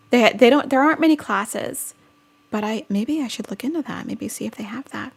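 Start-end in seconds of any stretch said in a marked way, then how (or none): 2.5-5.1 soft whisper to yourself; half to herself, whispered; whispered aside. Real, not acted. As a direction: mutter it as a private reminder. Whispered note to self.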